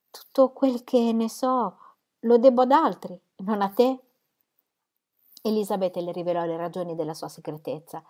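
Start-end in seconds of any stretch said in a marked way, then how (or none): 0.1-4.0 slow, higher hurt complaint; mock reproach. Jane chides Lizzie for secrecy, slow and higher. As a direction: lay on a playful grievance. Jane's gentle reproach to sister.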